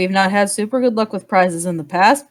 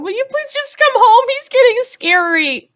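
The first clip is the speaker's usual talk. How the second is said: high-pitched tiefling calling from the background; pleading tiefling; 4 dB louder than usual. Acted. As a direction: plead in a high voice, slowing at the end. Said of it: "There's a tiefling in the background".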